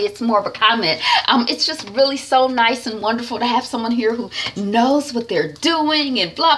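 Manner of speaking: high, gushing fake-sweet flattery; mock suck-up. Vlogger mocking Hadisa sucking up to the new boss, high gushing voice. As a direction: lay on the flattery in a mocking singsong.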